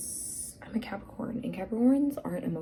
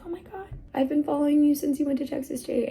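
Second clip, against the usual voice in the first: higher, fast gushing fan voice; excited fan girl; 8 semitones higher, faster than usual. Retold or imitated. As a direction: gush like a starstruck fan.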